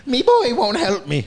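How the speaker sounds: mock Mister Krabs voice. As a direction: do a cartoon crab captain. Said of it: "more like Mister Krabs now".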